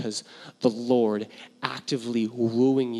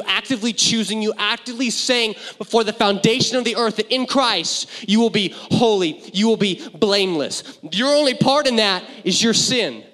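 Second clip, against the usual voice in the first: higher, louder building proclamation; climactic preaching; 8 semitones higher, 7 dB louder than usual. Acted. Pastor proclaiming God's relentless love, climactic. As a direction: build to the peak.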